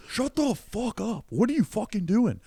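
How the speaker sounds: high, slow mock yell; comic telling off a heckler, in a high mock yell; retold furious yell. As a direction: relive snapping at the heckler. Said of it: "I go".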